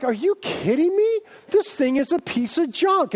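high-pitched mock outrage; disgusted outburst. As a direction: rant at a useless appliance, hammed-up. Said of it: "I am so disgusted".

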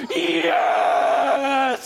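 high, loud shout of joy; joyful scream. Retold or imitated. Lady in his church shouting yes in her car, joyful scream. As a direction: shout it out like a victory cry. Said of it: "screamed at the top of my lungs going".

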